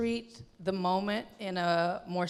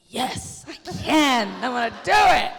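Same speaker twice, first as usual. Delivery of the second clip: excited yes; 8 dB louder than usual. Retold or imitated. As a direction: shout your yes with full excitement. Her eager yes to the exec.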